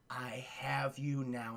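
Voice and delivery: slow, low, soft menace; menacing villain. Acted. Vader with Luke in his sights, low menacing. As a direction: gloat slowly, deep and quiet.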